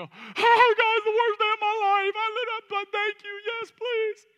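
very high, wailing mock-tearful outpouring; hammed-up distressed stranger. Preacher imitating people offered prayer, hammed-up high wail. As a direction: blurt it out in an exaggerated high wail. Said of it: "people go".

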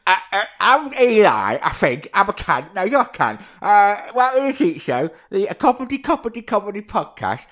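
mimicking Eli fumbling the intro, mocking. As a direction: ham up a bumbling host. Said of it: "Where you're like".

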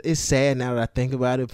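ordinary delivery

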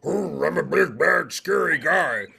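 hammed-up growling menace; slow, growly tough-guy voice; big scary guy. Shown as a demonstration. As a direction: play a mock villain, growling. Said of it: "get up there and go".

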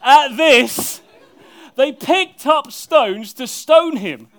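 loud, high, slow build; preacher thundering the climax; dramatic climax. Acted. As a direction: boom it out like the story's peak.

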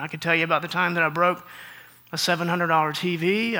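ordinary talk

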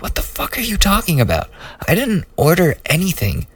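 high-pitched, fast indignant retort; angry retort. Retold or imitated. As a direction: squeak out the outrage in a rush. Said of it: "I'm sure it didn't sound like that".

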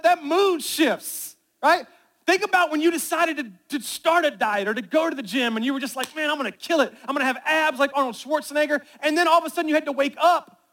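loud, high, driving sermon voice; full-force preaching. Acted. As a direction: preach it out to the whole room.

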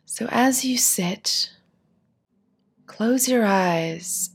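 calm, unhurried meditation voice with long pauses; guided meditation. Acted. Teacher guiding seated listeners through a body scan, guided meditation. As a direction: ease them gently through each instruction, leaving space to settle.

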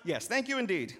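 forced smile. Acted. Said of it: "with a forced smile".

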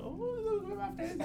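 put-on high, soft girly voice; girl voice. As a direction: pitch up into a girl's reply. Imitating girl in Uber.

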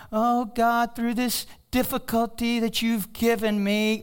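high, slow whiny prayer voice; put-on pious whine; mock pious prayer. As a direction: parody a self-pitying churchgoer.

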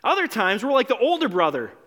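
usual speaking voice

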